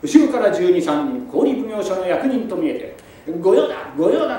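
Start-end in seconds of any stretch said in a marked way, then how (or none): 3.4-4.4 calling out. Acted. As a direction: yell after the fleeing man. Officers chasing, calling out.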